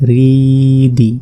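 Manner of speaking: রিদি with the রি drawn out one alif, very slow; stretched vowel. Shown as a demonstration. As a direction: hold the রি long, then a falling দি. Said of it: "এক আলিফ টেনে পড়তে হবে". (এক আলিফ টেনে রিদি)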